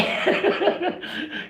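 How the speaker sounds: high, wheezy burst of laughter; laughing fit. Real, not acted. Streamer cracks up at chat comments, wheezing laugh. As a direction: crack up helplessly, almost voiceless.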